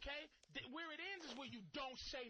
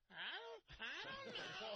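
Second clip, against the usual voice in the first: put-on higher, laughing whine of an indecisive girlfriend; whiny girlfriend; 8 semitones higher, slower than usual. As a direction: play the girlfriend who can't decide, giggling.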